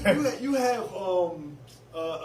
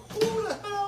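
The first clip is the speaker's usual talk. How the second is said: hugely raised pitch, outraged disbelief; high incredulous squeal; slower than usual. Retold or imitated. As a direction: shriek it in disbelief. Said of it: "everyone's like".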